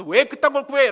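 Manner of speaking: loud scornful scolding retort; mock scolding. Preacher mimicking a God who scolds the asker, loud mock scolding. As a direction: bark it like an annoyed scold. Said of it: "라고 야단치는 분이 아니라".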